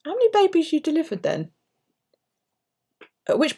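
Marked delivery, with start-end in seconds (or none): 0.0-1.5 teasing question. Retold or imitated. She quizzes the doctor.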